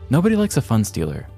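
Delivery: ordinary delivery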